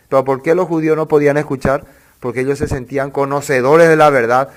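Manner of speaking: usual speaking voice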